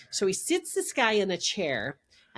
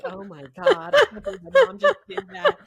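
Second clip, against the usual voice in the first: high, loud laughing mock wail; imitated mortified daughter; 8 dB louder than usual. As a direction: wail like an appalled kid, half laughing. Mortified daughter reacting to accident.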